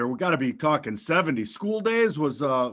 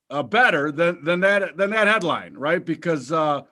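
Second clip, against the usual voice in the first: ordinary talk, nothing marked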